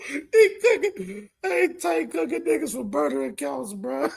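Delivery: high laughing voice, tears close; wheezing laughter. Real, not acted. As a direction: talk while cracking up. Laughing at roast.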